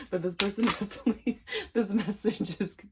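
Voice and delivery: talking through real giggles; laughter breaking into the words; giggling. Real, not acted. Reader to viewers, real giggling fit. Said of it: "I can't stop laughing".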